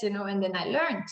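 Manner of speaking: ordinary talk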